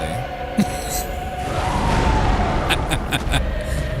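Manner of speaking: high shocked laugh; burst of laughter. Real, not acted. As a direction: laugh out in disbelief. Killed instantly by enemy.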